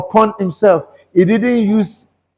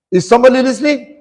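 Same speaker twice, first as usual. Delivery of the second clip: rapid preacher's call; 3 semitones higher, faster than usual. Real, not acted.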